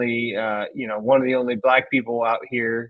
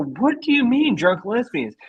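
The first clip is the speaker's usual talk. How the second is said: higher-pitched retold question; incredulous question, pitched up; baffled retort; 8 semitones higher than usual. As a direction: act out your bewildered comeback. Acted baffled question to old man.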